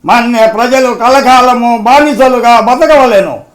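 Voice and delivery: high, loud, slow hero retort; bitter outcry in film-hero style; Krishna as Alluri Sitarama Raju. Acted. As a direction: shout it with drawn-out indignation. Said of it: "Krishna గారు నటించారు climax సన్నివేశం".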